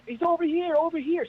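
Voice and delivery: high shouted beckoning; calling out. Retold or imitated. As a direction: yell to get the rescuers' attention. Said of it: "the guy's, like, waving us down".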